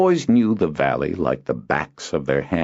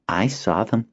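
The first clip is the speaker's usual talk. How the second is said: put-on boy Zinar; 6 semitones higher than usual. Boy telling his favorite tale, proud. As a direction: share a treasured secret.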